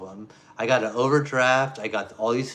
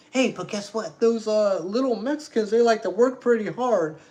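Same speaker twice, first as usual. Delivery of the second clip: higher, sarcastic boss voice; mock boss; 8 semitones higher, 4 dB louder than usual. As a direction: parody the boss praising cheap workers. Mocking boss defending cheap hires.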